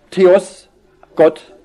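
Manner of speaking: "Theos" and "Gott" each punched loud and set apart; stressed THEOS. Shown as a demonstration. Preacher demonstrating the emphasised Greek word, "Theos" and "Gott" stressed loudly. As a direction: hit "Theos", pause, then hit "Gott". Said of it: "muss es hier betont vorangestellt heißen".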